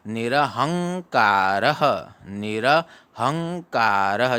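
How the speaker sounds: निरहंकारः slowly with a nasal ng before हंकारः; teacher repeats निरहंकारः slowly; slow recitation. Shown as a demonstration. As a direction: drill निरहंकारः slowly, a clear ng. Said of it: "ऐसे पढ़ा जाता है".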